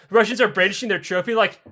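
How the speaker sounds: ordinary talk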